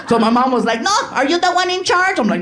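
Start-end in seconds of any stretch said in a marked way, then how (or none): 0.8-2.2 high-pitched bossy mom voice; pushy mother. Acted. Mother confronting Jay Leno backstage, high and pushy. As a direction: challenge him as a pushy mom.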